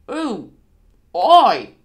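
drawn-out oh, then loud aye; dawning agreement. Acted. Slow driver realising he gets a pint, loud delighted assent. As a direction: let it dawn, then burst out. Dick grasps the offer.

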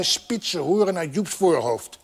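plain reading voice

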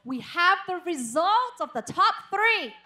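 high, rousing call to the crowd; hype announcer. Real, not acted. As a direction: whip up the audience with a rising announcer call. Host rouses the crowd for results. (giọng MC khuấy động khán giả)

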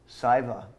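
"Siva" said SY-vah; "Siva" as SY-vah, settled tone; name pronunciation. Real, not acted. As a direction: say "Siva" with a long i, falling. Trying two readings of a name.

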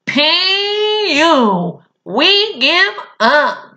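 high, slow mock yell of disgust; hammed-up giving up at the stink. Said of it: "the spiders yelled".

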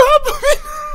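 helpless shrieking laugh; real high laughter; high squealing laugh. Real, not acted. Laughing hard after a joke.

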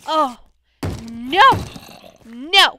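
very loud desperate cries; alarmed yells. Real, not acted. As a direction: yell in dismay. Still under attack.

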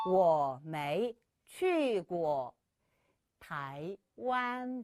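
very slow, tones drawn out; slow teaching read; slow model sentence. Shown as a demonstration. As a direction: model each syllable slowly. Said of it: "시작!".

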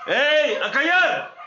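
shouting. Real, not acted. Shouting at noisy dogs.

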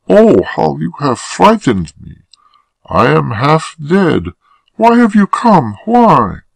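very high, distressed woman's voice; frightened woman, overwhelmed. Acted. Woman to her unexpected lover, frightened and distressed.

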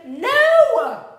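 high, loud, long-held no; emphatic refusal. Acted. Max answers the pleading wild things, emphatic refusal. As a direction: refuse at the top of the voice.

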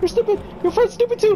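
high, loud yelled taunt, half-crying; frantic taunt. Real, not acted. Gamer escaping the monster, shrieking panicked taunts. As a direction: yell insults at the monster in panic.

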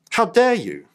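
second How dare you rising in outrage; indignant rebuke. Acted. Paul's indignant rebuke, second take.

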